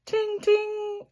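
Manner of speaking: high ringing ting; bell ting. A narrator's reading. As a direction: ring it out like a little bell. Bell sound in story.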